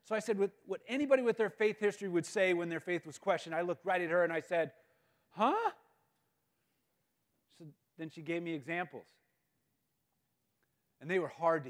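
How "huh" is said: high-pitched rising huh; incredulous. Retold or imitated. Church kid whose faith was questioned, comically incredulous. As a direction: squeak it upward, comically dumbfounded.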